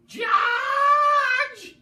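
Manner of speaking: shrieking his name at full pitch; piercing old-woman shriek; awful screech. A narrator's reading. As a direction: scream it from the next room. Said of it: "came the awful noise".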